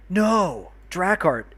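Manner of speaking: loud, high gasped shock; dismayed cry. Real, not acted. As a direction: cry out as you realize the mishap. Viewer next on list just left.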